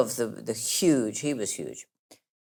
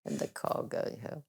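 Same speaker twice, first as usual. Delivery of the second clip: whispered; 11 dB quieter than usual. Retold or imitated. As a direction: whisper it under the breath. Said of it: "you think".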